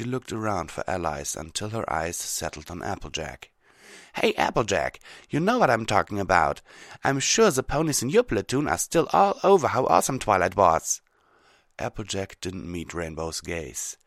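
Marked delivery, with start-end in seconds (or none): 4.1-11.0 loud, raised character voice; energetic, higher-pitched pony voice; brash Rainbow Dash. Acted. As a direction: project it to the whole group. Rainbow Dash addresses Applejack.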